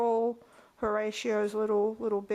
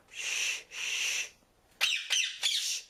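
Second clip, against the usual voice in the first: hushing; much slower than usual. Real, not acted. Owner shushing her noisy bird. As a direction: hush the bird.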